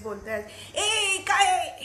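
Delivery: a hint of a mother yelling from a strained throat; mock throaty scolding. Therapist imitating a mother straining her throat, mock yell. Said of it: "त्याला back focused phonation असं म्हणतात".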